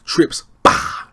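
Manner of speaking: loud breathy gunshot bah; imitated shotgun blast; gunshot noise. Gunshot in retold scene.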